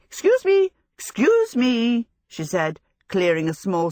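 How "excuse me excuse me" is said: high, loud polite calling. A narrator's reading. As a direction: push through the crowd. Clearing space in crowd.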